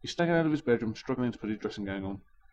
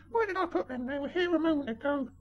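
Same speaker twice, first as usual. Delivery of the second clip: put-on dotty old lady. Old lady searching her bag.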